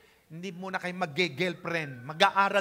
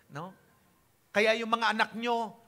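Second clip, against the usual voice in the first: ordinary talk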